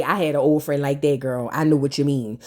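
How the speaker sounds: deep, soft put-on Nene Leakes voice. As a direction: drop low and purr like Nene. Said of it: "in my Nene voice".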